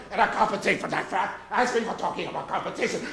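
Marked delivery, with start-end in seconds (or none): 1.5-3.1 bawdy nursery tale in mock German; put-on German-accented storyteller. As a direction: tell it with a hammed-up German accent. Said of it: "Tell the real story of Mother Goose in this accent".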